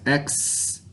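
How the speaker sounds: X as a soft unvoiced ks hiss; whispered letter sound. Shown as a demonstration. Teacher sounds out X in expert. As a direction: breathe the ks sound quietly. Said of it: "Is represented with the X".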